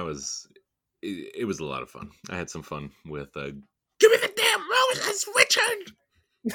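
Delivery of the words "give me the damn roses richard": very high, loud, drawn-out scream; furious quoted shout. Acted. Woman yelling at Richard over roses, furious shout. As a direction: shriek it at Richard in exasperation. Acting out an angry line from show.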